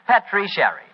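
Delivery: ordinary talk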